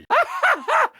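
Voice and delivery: high giddy mad scientist laugh; maniacal cackle. Acted. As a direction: cackle with delight. Mad scientist laughing at his acid.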